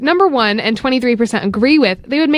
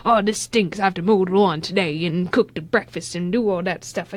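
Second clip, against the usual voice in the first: lower, softer put-on old grump; grumpy old person; 4 semitones lower, 5 dB quieter than usual. Co-host imitating someone old and set in their ways, grumpy. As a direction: attempt a grumbling old-person impersonation. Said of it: "nice try at a impersonation there".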